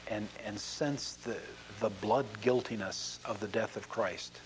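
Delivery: soft, earnest closing prayer. Real, not acted. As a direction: pray humbly for the congregation.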